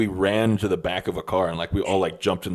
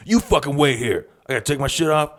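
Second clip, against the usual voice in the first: high-pitched bluffing threat; mock tough bluster; 5 semitones higher, 4 dB louder than usual. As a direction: puff yourself up and stall with threats. Friend acts out a bluffing threat.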